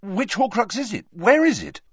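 high, eager questions; urgent questioning. Acted. Harry pressing Dumbledore about the Horcrux, high and urgent.

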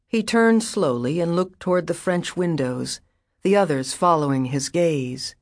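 usual speaking voice

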